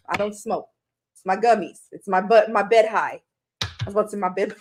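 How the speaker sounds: ordinary talk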